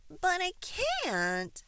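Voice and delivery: high, loud, drawn-out protest; protesting baby bird. A narrator's reading. Baby bird answering the giraffe, high protest. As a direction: stretch it out high like a little bird.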